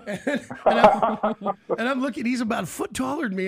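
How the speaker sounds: loud, laughing burst while telling the story; laughing through it. Real, not acted. Host telling guest about meeting Billy, laughing loudly.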